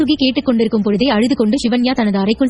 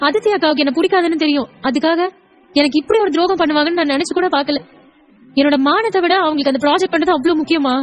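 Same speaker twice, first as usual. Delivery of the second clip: higher-pitched, hurt plea; tearful pleading; 4 semitones higher than usual. Acted. Wife begging her husband not to retaliate, tearful. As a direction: beg him to stay, wounded and fearful.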